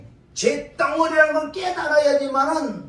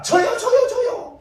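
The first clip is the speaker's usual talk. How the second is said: eager reply; 11 semitones higher, 4 dB louder, slower than usual. Retold or imitated. Eagerly answering Jesus' call.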